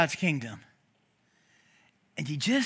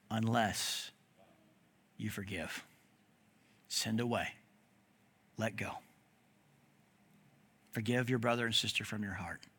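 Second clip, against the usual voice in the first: low, very soft, long pauses between phrases; hushed solemn warning; 7 semitones lower, 7 dB quieter than usual. Acted. Preacher reading Jesus' warning to the congregation, hushed. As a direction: let each phrase sink in quietly.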